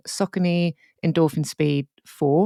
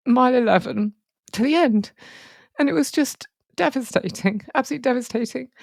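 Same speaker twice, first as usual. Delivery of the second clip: higher, strained voice fighting back tears; near tears; 6 semitones higher than usual. Real, not acted. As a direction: push through the memory as the voice wells up. Recalling painful race, near tears.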